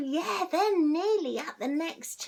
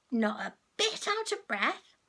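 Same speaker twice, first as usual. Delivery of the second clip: usual speaking voice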